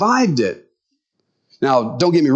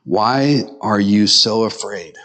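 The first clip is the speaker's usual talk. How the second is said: low, slow, measured question; making a statement; 6 semitones lower, slower than usual. Acted. Jesus to his disciples after the storm, calm statement. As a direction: put it slowly and low, like a statement. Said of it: "but he's making a statement".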